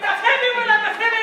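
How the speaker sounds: fast, high mock yelling; hammed-up frantic yell; exasperated uncle shout. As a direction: rattle it out loud as a fed-up uncle. Exasperated uncle repeats his word.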